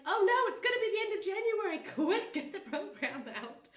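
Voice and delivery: high, loud, laughing panicked squeal; mock panic. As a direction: play your own deadline panic for laughs. Retold panic at the deadline.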